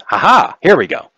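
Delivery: high, loud "aha" of finding it; light up as you find the thing; pleased discovery. Acted. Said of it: "looks almost confused for a moment and says".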